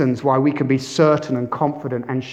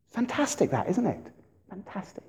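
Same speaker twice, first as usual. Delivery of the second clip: hushed delight in the point; quiet savoring; 3 semitones higher, 11 dB quieter than usual. Real, not acted. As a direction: softly savour the idea, fading to a whisper. Preacher savoring point, dropping to whisper.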